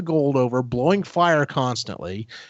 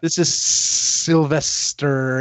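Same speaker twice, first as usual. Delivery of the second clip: drawn-out s like a snake; much slower than usual. Retold or imitated. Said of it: "She's drawing out her S's".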